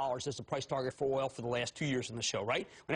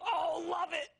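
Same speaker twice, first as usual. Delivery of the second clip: excited approval; 12 semitones higher than usual. Real, not acted. Host reacts to pick.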